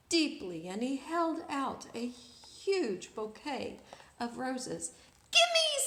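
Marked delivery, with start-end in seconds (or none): 5.3-5.8 high, loud screechy parrot squawk. Acted. Reading a story, the rude parrot squawks at the prince. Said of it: "said the parrot".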